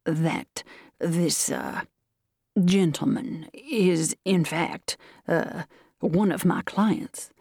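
slow, halting colonel; courteous, hesitant explanation; pained courtesy. A narrator's reading. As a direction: pick each word with polite discomfort. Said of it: "with a pained yet courteous smile".

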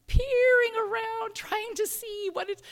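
high, slow, drawn-out words; wary peering. Acted. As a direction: stretch it out high as if creeping closer. Acts out peering into bathroom.

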